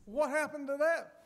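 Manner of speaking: lightly voiced puzzled wife, high; a hint of wife. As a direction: play the curious wife, mildly. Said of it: "she said".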